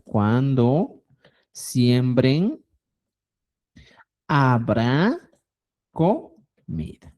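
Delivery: "Cuando siembren, habrá comida" slow with pauses between words; slow careful translation drill. Shown as a demonstration. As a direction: spell out the translation one word at a time. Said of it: "Estoy diciendo".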